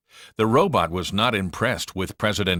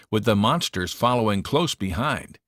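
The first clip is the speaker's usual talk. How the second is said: ordinary delivery, nothing marked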